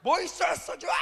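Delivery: high, loud mock curse; hammed-up angry retort; swearing back. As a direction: snap back at the caller. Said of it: "같이 쌍욕을 해줬어요".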